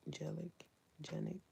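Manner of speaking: ordinary talk, nothing marked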